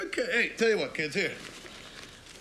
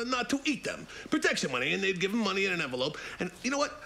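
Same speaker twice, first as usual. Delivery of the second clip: usual speaking voice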